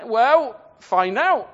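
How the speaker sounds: loud, emphatic comeback; brusque retort. Acted. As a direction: throw the question straight back, loudly. Acts out prophets' brusque reply.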